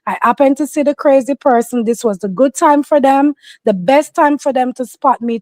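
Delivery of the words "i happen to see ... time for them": ordinary delivery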